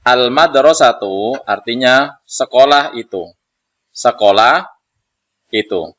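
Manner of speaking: loud teacher reading with the meaning; loud model reading. Shown as a demonstration. Teacher loudly reads the word and gives its meaning. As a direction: project the word and its meaning to the back row. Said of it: "Maka kita baca".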